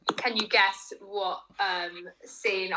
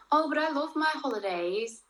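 put-on Scouse accent; 6 semitones higher than usual. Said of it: "That was a shit accent".

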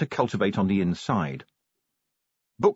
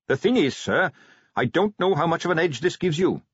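put-on higher eager young Carrot voice; 6 semitones higher than usual.

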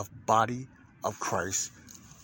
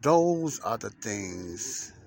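drawn out slowly, every word held; slow, deliberate podcast teaser read; slow dramatic opener; much slower than usual. Acted. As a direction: let each word hang, like a teaser. Dramatic opening line, then repeated.

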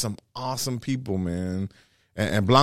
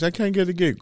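whiny comic; 7 semitones higher than usual. Retold or imitated. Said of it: "all you young comics".

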